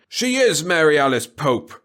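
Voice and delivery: loud, slow, booming denial; thundering. A narrator's reading. Professor thundering at Jack to deny the truth. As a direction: thunder the name at him. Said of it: "Kesserik thundered at him".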